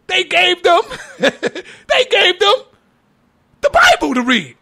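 high, incredulous laugh through the words; laughing insistence. Real, not acted. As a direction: repeat it while breaking into laughter. Laughs while correcting the argument.

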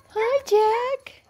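very high little greeting; cooing pet talk. Real, not acted. Owner saying hi to puppy Jack, cooing pet talk.

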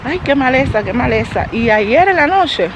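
ordinary talk, nothing marked

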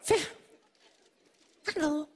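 ordinary talk

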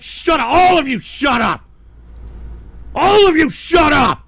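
furious, forceful outburst; angry shout. Real, not acted. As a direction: yell to silence everyone. Silencing the whole chat.

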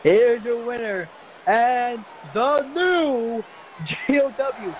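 slow, shouted winner call; announcer shout. Pretend. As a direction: bellow it like a ring announcer.

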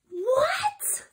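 very high, rising squeak; mortified high-pitched disbelief; shocked. Acted. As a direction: yelp it upward in shock. Shocked at silly song just sung.

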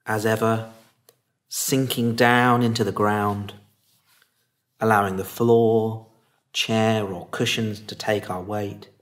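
calm, unhurried meditation lead with long pauses; guided meditation. Acted. Teacher settling listeners into meditation posture and body, calm guiding voice.

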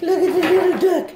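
high laughing duck voice. Pretend. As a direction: quack it out, high and giddy. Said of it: "that's not how a little baby duck sounds".

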